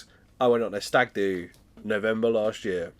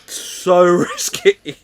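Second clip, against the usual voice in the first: laughing; 9 semitones higher, 11 dB louder, much slower than usual. Real, not acted.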